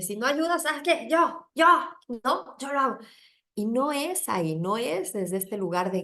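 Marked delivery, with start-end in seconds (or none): 0.0-3.0 higher, defiant; I'll do it myself, fed up; defiant go-it-alone. Retold or imitated. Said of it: "personalidad en la que yo dije". (desafiante, lo hago sola)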